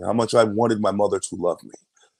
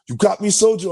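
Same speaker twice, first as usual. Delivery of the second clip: high, louder imitated sergeant's check; Drill Sergeant Walsh; 10 semitones higher, 5 dB louder than usual. Drill sergeant demanding an answer from recruit, imitated forceful voice. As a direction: demand a soldier's acknowledgement. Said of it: "Drill Sergeant Walsh comes up to me".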